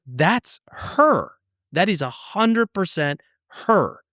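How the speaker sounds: high, insistent imitation of her panic; retold freak-out. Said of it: "She freaked out".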